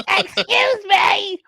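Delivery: squeaky high-pitched excuse me. Pretend. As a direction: stretch it out tiny and squeaky. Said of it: "let me get my voice changer".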